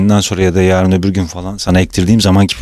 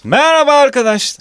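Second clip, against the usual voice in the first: very high cartoon cat voice; squeaky pet voice. Voicing a cat greeting.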